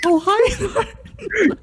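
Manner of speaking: squeaky mock greeting, laughing; very high voice breaking into laughter; super high-pitched imitation. Co-host echoing The Room quote, super high-pitched voice.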